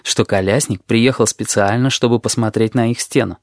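ordinary delivery

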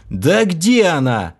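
slow, pressed-out impatient cry; exasperated outburst. A narrator's reading. The trapped hero loses patience, exasperated outburst. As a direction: burst out, fed up with waiting. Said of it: "взорвался я".

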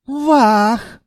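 high, loud cry of wonder; amazed djinn. A narrator's reading. Djinn gaping at the treasury wall, amazed. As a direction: let out a high awed exclamation.

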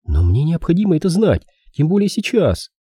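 high-pitched urgent plea; pleading. A narrator's reading. Girl needs the detective's help, high pleading. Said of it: "Она умоляюще наклонилась к детективу".

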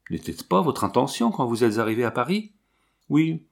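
usual speaking voice

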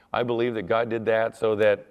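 ordinary talk, nothing marked

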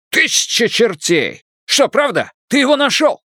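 put-on thrilled old Irishman; excited Irish accent. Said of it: "Ирландский акцент работал на всю катушку".

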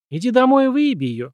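sharp high command. A narrator's reading. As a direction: order him out, high and firm. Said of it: "скомандовала Карен".

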